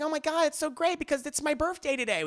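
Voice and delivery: high, fast giddy squeal; mock gushing over birthday flowers. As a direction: gush like a birthday girl. Judith gushing in recap.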